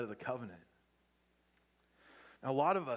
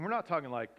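normal talking voice

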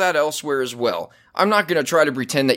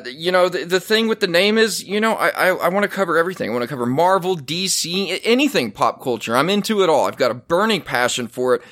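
ordinary delivery

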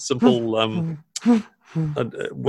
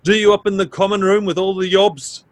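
booming sing song welcome; 9 semitones higher than usual. Acted. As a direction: welcome them in like a jolly landlady. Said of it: "and it's like".

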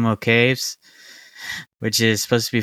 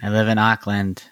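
put-on New Zealand accent. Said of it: "Give me your best New Zealand accent".

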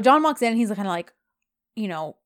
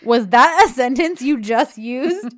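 slow, loud, higher mocking question; sarcastic disbelief; 4 semitones higher, 8 dB louder than usual. John questions Yeet's choice of words, sarcastic. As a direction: stretch it out in loud mock disbelief. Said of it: "John is so sarcastic".

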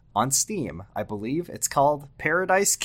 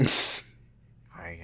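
whispered asmr role play; 4 dB quieter than usual. Pretend. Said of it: "asks for A S M R roleplay".

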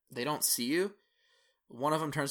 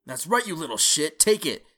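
loud jeering taunt, rising higher; taunting bully; 6 semitones higher, 9 dB louder than usual. Acted. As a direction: gloat over him loudly. Bully taunting the beaten kid.

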